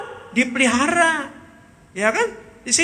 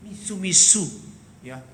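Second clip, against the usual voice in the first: ordinary talk, nothing marked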